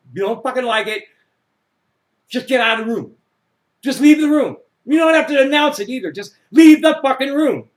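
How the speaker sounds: loud, high angry yelling; angry rant. Real, not acted. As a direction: yell it out, fed up. Ranting about leaving the room.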